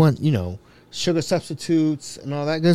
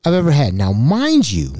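usual speaking voice

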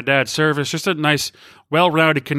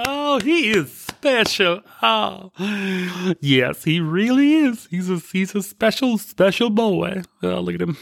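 mock cooing; 6 semitones higher than usual. Host fawning over special boy, high mock cooing. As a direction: coo as if admiring a special boy.

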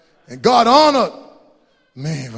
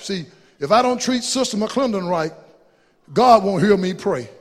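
ordinary delivery

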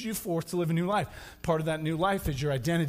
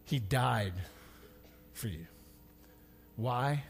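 tender close; 8 semitones lower, 9 dB quieter than usual. Acted. Pastor closing softly over music, tender. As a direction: hush it down like a prayer.

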